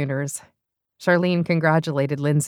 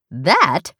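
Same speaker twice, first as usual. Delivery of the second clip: incredulous; 6 dB louder than usual. Acted. Beth incredulous.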